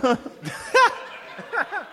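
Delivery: very slow, high disbelieving whisper; stunned. Retold or imitated. [name] hears the confession, slow high stunned disbelief. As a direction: drag it out in shock. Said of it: "I was like".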